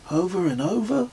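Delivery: can't believe it; incredulous Kez. A narrator's reading.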